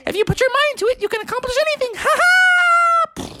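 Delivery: very high, squeaky character voice; squeaky pep talk. Acted. Character recalls surfing at Mavericks, squeaky and triumphant. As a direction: cheer them on in a tiny high voice, ending in a loud ha-ha.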